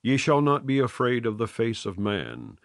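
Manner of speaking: ordinary delivery, nothing marked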